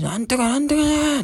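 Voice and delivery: imitating the old man. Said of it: "そのおじさんの声".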